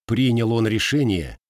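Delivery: plain reading voice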